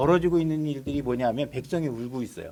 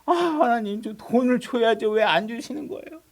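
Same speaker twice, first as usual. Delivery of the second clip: high, raised whining plea; mock tearful complaint; 6 semitones higher, 3 dB louder than usual. Believer demands money and help from God, mock tearful wail. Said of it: "그러고 막 우는 거예요".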